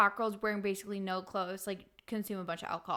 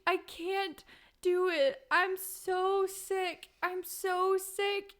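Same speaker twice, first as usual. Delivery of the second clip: high, very slow miserable whimper; retold sobbing plea to her sister; whiny sick voice; 9 semitones higher, much slower than usual. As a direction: moan it out like you're too sick to move. Crying hungover in mom's closet.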